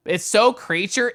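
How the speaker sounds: delighted; excited gush. Real, not acted. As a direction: squeal over the cute avatar. Gushing over avatar.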